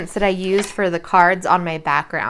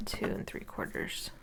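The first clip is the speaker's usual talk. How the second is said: breathy whisper of the measurement; whispered count; 13 semitones lower, 17 dB quieter than usual. Real, not acted. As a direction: whisper it to yourself while measuring. Counting cuts under her breath.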